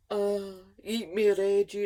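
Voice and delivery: slow, loud coaxing food voice; tempting hot dog. Acted. Hot dog luring the hungry boy, slow and coaxing. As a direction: drag it out like a temptation.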